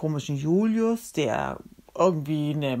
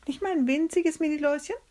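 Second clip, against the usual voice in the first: tiny high-pitched pleading question; high, teasing wheedle; wheedling; 12 semitones higher, faster than usual. Acted. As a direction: squeak it out quick and small. Friend wheedles about tiny louse.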